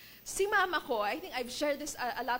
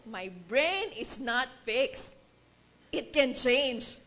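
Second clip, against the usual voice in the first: normal talking voice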